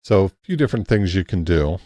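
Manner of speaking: normal talking voice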